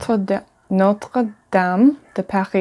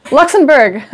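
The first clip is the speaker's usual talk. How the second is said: laughing; 6 semitones higher than usual. Real, not acted.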